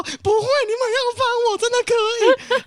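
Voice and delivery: begging. Retold or imitated. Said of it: "我就说".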